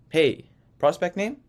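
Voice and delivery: rising question tune on "Hey, prospect name?"; curious questioning tone; asked as a question. Shown as a demonstration. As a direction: end "prospect name" on an upward, asking lift. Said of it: "in a tonality as if it's a question".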